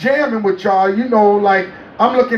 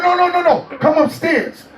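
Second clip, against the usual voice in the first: Prince insisting; 8 semitones higher than usual. Retold or imitated. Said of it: "He said".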